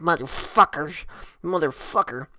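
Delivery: imitating her angry grumbling under the breath; mad muttering. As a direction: mutter broken curses as if stomping off. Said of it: "real fucking mad".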